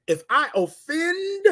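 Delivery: very high, stretched-out "offend"; slow oratorical "If I offend", left hanging high; emphatic speaking style. Shown as a demonstration. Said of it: "you gotta talk like that".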